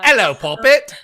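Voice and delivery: put-on high, loud lilting English accent; sing-song English greeting to a friend. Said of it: "Lilting English accent".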